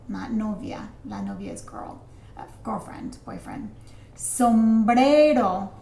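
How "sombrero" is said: "Sombrero" loud and drawn out; slow, emphatic teacher's model; slow model pronunciation. Shown as a demonstration. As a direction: stretch each syllable of "Sombrero" for the class. Teacher models a vocabulary word.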